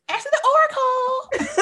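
high, loud mimicry breaking into laughter; Crystal. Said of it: "In my crystal's voice".

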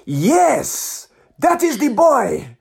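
put-on high, slow, ecstatic Nigerian accent; soaring Nigerian-accented rave; imitated Nigerian fan. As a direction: proclaim it joyfully in a Nigerian accent. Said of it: "fantastic Nigerian guy, full of life".

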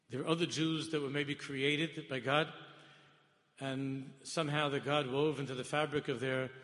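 loud, rising sermon at full force; fiery preaching. Acted. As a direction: hammer the point home to the congregation. Preaching to congregation.

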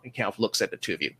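ordinary delivery

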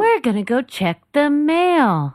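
put-on high, slow, sing-song kids' show host; Steve from Blue's Clues. Mocking the band as a kids' show host, high and slow. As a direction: talk to toddlers like a children's TV host. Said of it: "Steve from Blue's Clues?".

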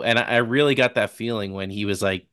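ordinary talk

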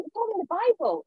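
high-pitched excited vicar imitation; happy vicar. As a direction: enthuse like a delighted vicar. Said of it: "so happy for me".

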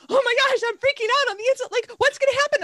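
high, loud, rushed inner panic; excited freak-out. Retold or imitated. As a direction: squeal it like a reader who can't bear the suspense. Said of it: "and then I would be like".

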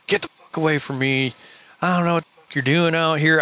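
imitating the biker's cursing, pitch climbing; mock-irate biker voice; retold angry cursing. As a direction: tell them off, rising as you go. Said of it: "same thing".

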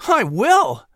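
put-on a boy overflowing with gratitude; excitedly. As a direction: gush the thanks slowly, overjoyed. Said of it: "Patrick said excitedly".

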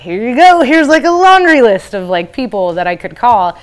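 excited helpful friend. Retold or imitated. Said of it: "he's like".

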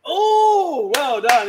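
loud, high delighted cheer; excited praise. Real, not acted. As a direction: cheer the right answer. Praising the right answer.

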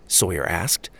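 ordinary delivery, nothing marked

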